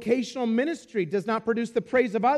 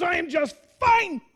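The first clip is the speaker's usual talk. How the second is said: high, emphatic mock indignation; curt offended retort; 8 semitones higher than usual. Seatmate repeating his rebuff to the preacher, curtly indignant. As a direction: imitate an affronted loud man, pitched up. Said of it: "same loud voice".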